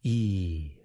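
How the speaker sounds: long, low, drawn-out "E"; long vowel demo. Shown as a demonstration. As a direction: hold "E" as one steady ee sound. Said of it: "have the same vowel sound".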